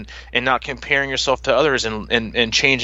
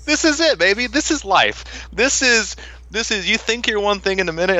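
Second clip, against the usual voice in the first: higher, laughing mock wisdom to the toddler; laughing through words; 10 semitones higher than usual. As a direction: laugh it out while telling him this is life. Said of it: "I was like".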